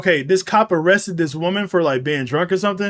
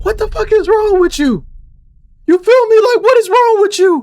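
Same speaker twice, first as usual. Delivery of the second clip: high-pitched, exasperated demand; high, loud disbelief, voice pushed way up; what-is-wrong-with-you squawk; incredulous outburst; 14 semitones higher, 4 dB louder than usual. Real, not acted. Mock outrage at the cop.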